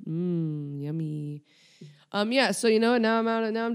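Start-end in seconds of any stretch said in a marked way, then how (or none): 0.1-1.4 mock savouring. Sarcastic savouring of own loogies.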